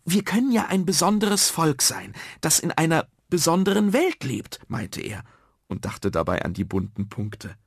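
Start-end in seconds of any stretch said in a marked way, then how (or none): 0.1-4.6 put-on high, loud eager child; eager idea. Nuno suggests a special world, eager. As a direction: pitch the idea high and keenly. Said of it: "Nur Nuno hatte eine Idee".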